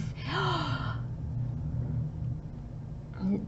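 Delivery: long breathy unvoiced intake; soft gasp of wonder. Real, not acted. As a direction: gasp softly, enchanted.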